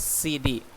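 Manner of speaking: C D as two separate letters; name C and D each by itself; one by one. Shown as a demonstration. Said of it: "one by one".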